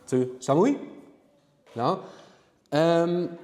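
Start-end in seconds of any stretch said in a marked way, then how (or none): 0.4-0.8 "Samui" with a high rising end; informal question intonation. Shown as a demonstration. Teacher contrasts informal with formal Japanese, rising question tone. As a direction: ask "Samui" by lifting the pitch at the end. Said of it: "you could just do it with your voice".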